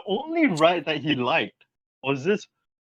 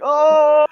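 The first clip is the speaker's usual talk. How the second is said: high, loud "oh" yelp; retold slide scream; 7 dB louder than usual. Rider reenacting the drop down the slide, high yelp. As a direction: cry out as the slide drops away.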